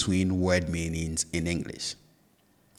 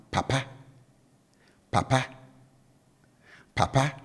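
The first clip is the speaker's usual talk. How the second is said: "papa" low first syllable, raised last syllable; low-high tone; 6 semitones higher than usual. Shown as a demonstration. Teacher showing learners the third Twi word, low-to-high tone on "papa". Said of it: "tone on the first syllable is down".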